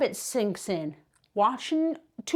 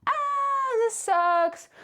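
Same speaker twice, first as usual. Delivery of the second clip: mock despair; 12 semitones higher, much slower than usual. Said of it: "you're like".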